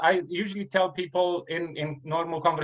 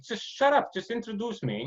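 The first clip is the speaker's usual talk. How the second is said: imitating his own annoyance, much higher; exasperated retort; 8 semitones higher than usual. Comedian fed up with the MC's jokes, exasperated high retort. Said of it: "and I'm like".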